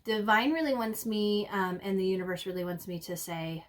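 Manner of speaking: ordinary delivery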